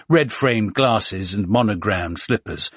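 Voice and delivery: ordinary delivery, nothing marked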